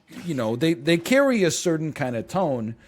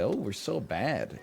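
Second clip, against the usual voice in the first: slow, softer mock-naughty line; playful mock guilt; 2 semitones lower, 7 dB quieter, slower than usual. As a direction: tease like sharing a guilty secret. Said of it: "you could be like".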